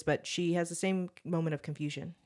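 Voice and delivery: ordinary delivery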